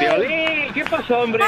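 high-pitched, drawn-out exclamation; relieved protest. Real, not acted. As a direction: cry out on learning it was a prank. Prank revealed, relieved protest to host.

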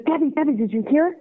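put-on high-pitched, giddy teenage gushing; excited teenager. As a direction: imitate a friend bursting with news. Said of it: "sounded like an excited teenager".

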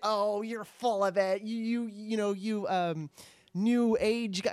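a hammed-up scoffing critic; scoffing sceptic. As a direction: sneer it like a doubter. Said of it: "who's gonna say".

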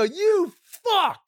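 high, drawn-out indignant curse; mock outrage. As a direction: cuss out a prankster, playfully. Acted reaction to prank.

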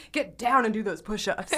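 abrupt mock drill-instructor order; stern Hyde side. Friend imitating the instructor's Hyde side, mock stern. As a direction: drop from cheery into a stern command. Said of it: "it's like a Jekyll and Hyde situation".

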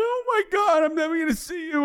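high, wailing imitation of his crying young wife; a man doing his wife's panicked crying voice; mimicking crying wife. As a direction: pitch way up, mock-sobbing her words. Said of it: "she's crying".

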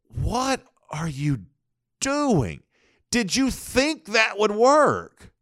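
incredulous. Real, not acted. Incredulous at the clip.

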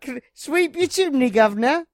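high mock Cockney chimney sweep. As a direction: pitch up into a cheeky Cockney street hawker. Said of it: "chimney sweep".

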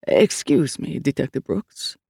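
lightly voiced older man, calm and measured; a hint of a priest keeping things calm; deep calm older man. A narrator's reading. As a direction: priest interrupting the detective, understated calm. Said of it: "a man's, deep, older, and studiously calm".

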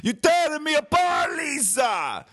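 high, loud strained yell; hammed-up tortured movie yell; mock anguished shout. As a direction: wail it like a bad melodrama. Famous movie line impression.

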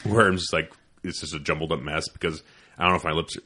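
ordinary talk

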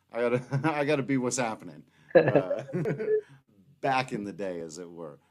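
usual speaking voice

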